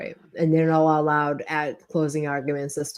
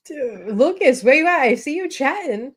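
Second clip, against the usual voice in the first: much higher and louder, calling him out; 9 semitones higher, 4 dB louder than usual. Real, not acted. Host teasing Lucas for typing in chat: loud high call.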